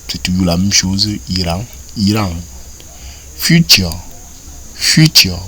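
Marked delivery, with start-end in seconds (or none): none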